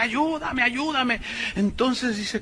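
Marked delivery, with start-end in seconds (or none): 0.0-1.2 softer, higher mock cry for help; badly faked wailing, kept small; imitated crying plea. Pastor imitating the woman in hell, mock pleading. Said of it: "que lloraba y gritaba".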